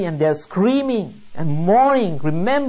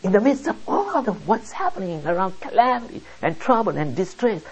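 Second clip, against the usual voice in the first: normal talking voice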